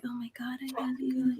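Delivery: breathy mock whisper; hushed sweater-acting confession. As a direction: whisper it like a TV drama actor. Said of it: "everybody's whispering".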